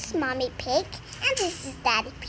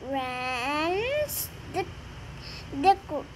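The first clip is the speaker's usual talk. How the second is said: plain reading voice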